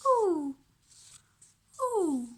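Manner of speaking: "ooh" drawn out high and loud; ooh sound demo. Shown as a demonstration. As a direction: model the double-o "ooh". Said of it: "when we have lots together, it's".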